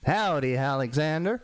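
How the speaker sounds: southern-drawl dwarf, slightly lifted; southern dwarf hailing a friend. Shown as a demonstration. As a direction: greet in a put-on southern drawl. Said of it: "with a southern drawl".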